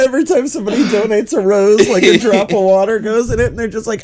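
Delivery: normal talking voice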